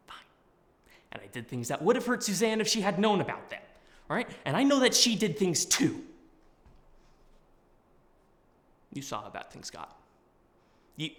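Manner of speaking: put-on pleading with an old friend; earnest, urgent confession; dramatic monologue. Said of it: "doing a monologue".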